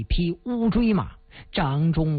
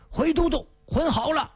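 much higher, eager soldier's report; 8 semitones higher than usual. Acted. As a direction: snap out the report to the commander.

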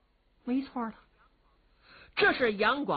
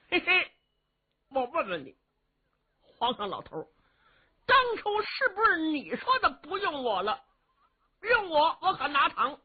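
brash simpleton warrior; 10 semitones higher than usual. Acted. Young warrior cheekily addresses the old emperor, high and brash. Li Yuanba voiced by storyteller.

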